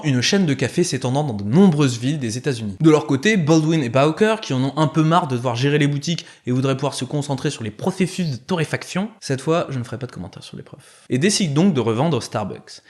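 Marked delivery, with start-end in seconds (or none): none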